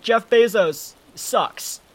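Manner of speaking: hammed-up protester's call, stretched out; mock heckling. Acting out someone punching an Amazon worker, mock heckling. Acts out shouting at warehouse worker.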